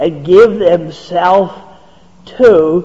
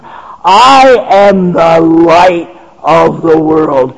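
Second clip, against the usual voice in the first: preaching at full force; 4 semitones higher, 4 dB louder than usual. Acted. Preacher proclaims to the congregation, fervent and rising. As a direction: build the list like a pulpit crescendo.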